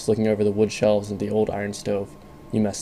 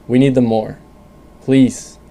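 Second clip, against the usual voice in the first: louder, desperate begging; loud pleading; 3 semitones higher, 8 dB louder, slower than usual. Acted. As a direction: plead over the storm noise. Father begs for food.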